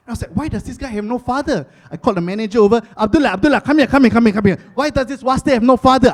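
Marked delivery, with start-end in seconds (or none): 0.3-1.7 acted outrage. 3.0-6.1 loud, high, urgent summons; mock outrage. Outraged customer calls the manager over, loud and frantic.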